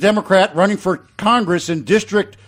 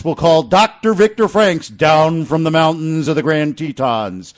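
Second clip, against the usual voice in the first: normal talking voice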